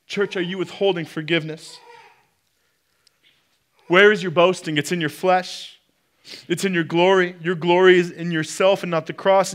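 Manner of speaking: rising preacher's rebuke; forceful preaching. Acted. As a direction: press the church hard. Preacher pressing the church.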